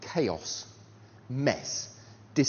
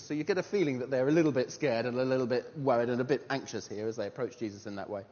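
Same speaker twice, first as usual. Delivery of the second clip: ordinary delivery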